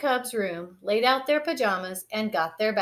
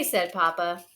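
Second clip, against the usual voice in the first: plain reading voice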